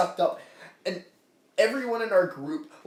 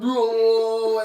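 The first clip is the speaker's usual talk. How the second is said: higher, loud drawn-out mock wail; pixelated victim's whoa; 5 semitones higher, 7 dB louder than usual. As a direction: imitate being zapped and sucked away. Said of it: "they're like".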